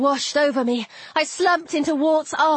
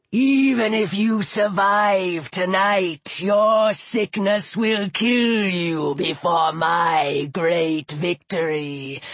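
deep, slow gloating serpent; Apophis; 6 semitones lower, much slower than usual. A narrator's reading. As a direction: foretell death in long, low words. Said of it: "Apophis spoke from an ivory shapti".

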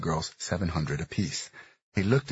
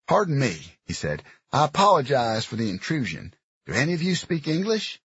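gruff Southern man; 9 semitones higher, 5 dB louder than usual. Acted. Dox addressing the girls.

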